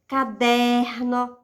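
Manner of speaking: "Caderno" slowly, with a long "der"; stress on penultimate syllable. Shown as a demonstration. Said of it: "penúltima". ("Caderno" devagar, com "der" forte e alongado)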